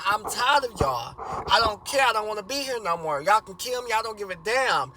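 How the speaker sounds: loud and high, spitting every word out; angry shouting. Retold or imitated. Son yelling at parents who keep beating him, furious shout. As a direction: yell it all out, holding nothing back. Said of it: "still speaking his mind".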